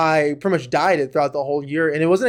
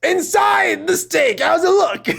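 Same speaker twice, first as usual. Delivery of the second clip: insistent shout; 13 semitones higher, 4 dB louder, much slower than usual. Real, not acted. Insisting on steak doneness.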